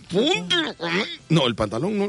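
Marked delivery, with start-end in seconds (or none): 0.1-1.0 high put-on voice cut off; mock president. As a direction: squeak the warning and break off. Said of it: "así le decía".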